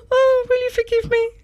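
high, hammed-up plea for forgiveness; hammed-up high plea for forgiveness to the guest; mock plea. Mock plea after teasing.

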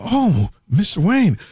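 high-pitched gushing welcome; fawning store staff. Acted. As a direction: greet a big spender in a high, eager voice. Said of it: "And they go".

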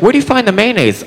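put-on high, sweet girl asking a question; girly voice. Girl asking where the mayonnaise is, high and sweet. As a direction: pitch up into the innocent girl. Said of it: "she's like".

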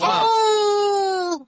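long high wolf howl. Pretend. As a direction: throw your head back and howl like a wolf. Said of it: "Can you do that coyote howl for us?".

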